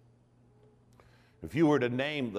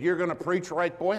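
higher and louder, mock disbelief; teasing church lady; 7 semitones higher, 5 dB louder than usual. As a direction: poke fun at the young preacher.

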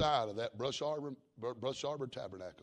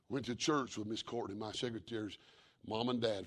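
ordinary talk, nothing marked

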